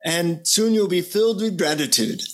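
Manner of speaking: put-on instructing guru in an Indian accent; lilting Indian-accented teacher voice. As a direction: imitate an Indian guru giving a lesson. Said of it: "he says in his Indian accent".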